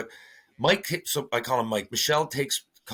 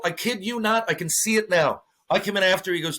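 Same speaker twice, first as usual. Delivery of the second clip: ordinary talk, nothing marked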